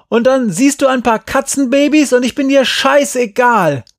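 very high, loud accusation; hurt yelling. A narrator's reading. Boy feels dropped for the kittens, hurt yelling. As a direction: bellow it, wounded and furious. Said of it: "brüllte Glenn zurück".

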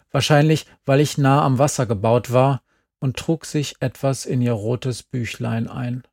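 normal talking voice

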